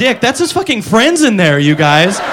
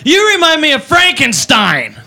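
put-on drunk party girl; 4 semitones higher, slower than usual. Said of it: "She goes".

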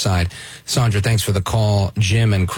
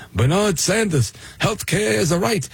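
Bernie impression; 10 semitones higher than usual. Mocking Bernie Sanders impression.

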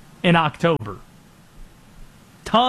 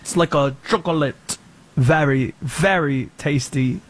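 French accent; 4 semitones lower than usual. Shown as a demonstration. Adding to the accent bit, clumsy French accent. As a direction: stretch it out in cartoonish French. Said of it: "Like France".

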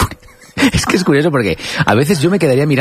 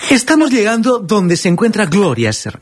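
high, slow investigative-reporter drawl; suspenseful, over-enunciated TV report; Gloria Serra impression; 8 semitones higher, slower than usual. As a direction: stretch it out like a dramatic TV reporter. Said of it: "a Gloria, Gloria, Gloria".

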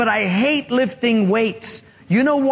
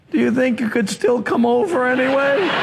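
hopeful plea; 4 semitones higher than usual. Acted. Punchline, tired woman still begs help.

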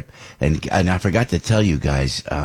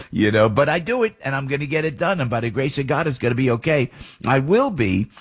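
ordinary talk, nothing marked

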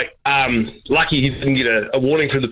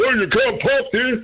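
gruff bodybuilder; 10 semitones higher than usual. Retold or imitated. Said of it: "comes out and goes".

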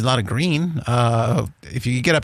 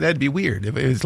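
ordinary talk, nothing marked

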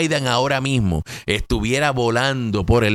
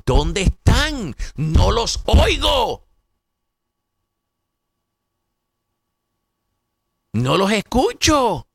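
1 taunting; 7 semitones higher, slower than usual. Real, not acted. Taunting silent opponents.

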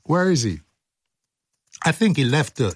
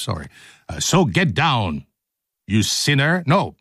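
normal talking voice

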